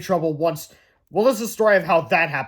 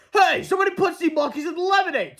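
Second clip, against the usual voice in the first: much higher outraged yell; angry shout; 11 semitones higher than usual. Acted. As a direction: yell the complaint. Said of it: "people shouting".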